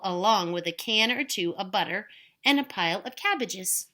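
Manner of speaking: ordinary delivery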